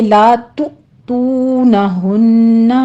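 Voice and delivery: very slow chanted tajweed reading; measured Quran recitation, vowels held two counts; slow Quranic recitation. Shown as a demonstration. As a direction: recite it slowly as a model for students. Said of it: "لا میں الف مدہ".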